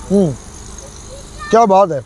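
usual speaking voice

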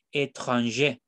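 "étranger" with a clear opening é and stressed ending; French pronunciation. Shown as a demonstration. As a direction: sound the first é in "étranger", stress the last. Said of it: "La E acentuada del inicio se pronuncia". ("étranger" en francés, con la é inicial pronunciada)

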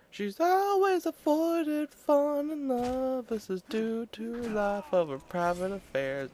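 very high, slow, drawn-out announcer parody; high slow movie-trailer mock voice; mock trailer narrator.

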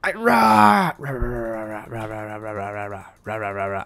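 long roar dying into low, soft stuttering; roar, then let it rumble down; angry roar. Pretend. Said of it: "take my anger out".

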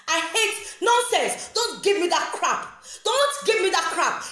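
high, emphatic angry rejection; indignant anger. Real, not acted. Rejecting a critic's nonsense, indignant and heated.

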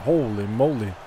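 ordinary talk

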